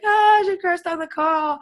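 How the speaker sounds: high, mock-prissy tattletale voice; imitating Al. Imitating Al telling on her for cursing, high mock voice. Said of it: "He'd be like".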